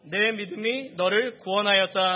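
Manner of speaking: loud, slow, declaimed verse; projected, measured phrase by phrase; scripture reading aloud. Real, not acted. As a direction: read out for a congregation to follow. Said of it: "우리 함께 읽겠습니다".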